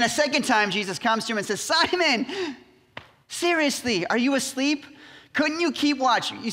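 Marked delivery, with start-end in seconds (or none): 1.6-6.3 high-pitched, incredulous scolding; acted reproach, pitched well up; exasperated disbelief. Jesus waking Simon a second time, exasperated disbelief. Jesus finds Peter asleep again.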